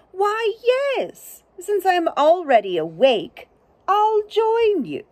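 high, sleepy owl, slow at the end; a woken owl agreeing to tag along. Acted. Owl answering the turtle's invitation, high and drawn out.

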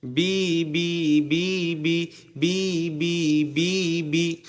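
drawn-out "বি" with a long ee; slow syllable demo on "বি"; stretched zer sound. Shown as a demonstration. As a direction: spread and hold each "বি". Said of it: "এটাকে একটু প্রসারিত করবেন".

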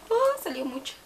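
quick high startled exclamation; surprised oops. Real, not acted. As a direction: react as the bottle spills too much.

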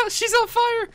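high, loud laughing cry; laughing shock. Real, not acted. Laughing as woman burns.

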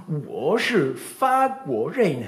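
teacher's model. Retold or imitated. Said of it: "Elle disait".

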